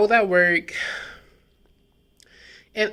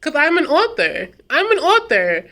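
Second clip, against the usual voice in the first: proud; 8 semitones higher, 5 dB louder than usual. Real, not acted. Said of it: "that feels so good to say".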